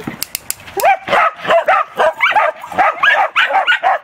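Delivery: high, helpless burst of laughter; real laugh. Real, not acted. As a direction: crack up at the dog. Amused by her own dog voice.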